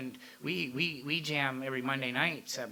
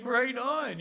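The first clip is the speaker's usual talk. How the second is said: a high, loud, hammed-up "right on"; mock cheer; 10 semitones higher, 6 dB louder than usual. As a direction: fake enthusiasm, playing along. Mock cheer at metal-averse singer.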